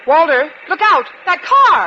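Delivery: loud, high cry of alarm; alarmed warning. Acted. Teacher cries out to Walter about the car ahead. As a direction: shout the warning in sudden fright.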